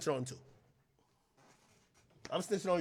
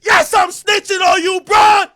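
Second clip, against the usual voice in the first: louder, rising rant; yelling; 6 semitones higher, 6 dB louder than usual. Real, not acted. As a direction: shout it out, heated. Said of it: "rising yelled snitching rant".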